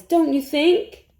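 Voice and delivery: ordinary delivery, nothing marked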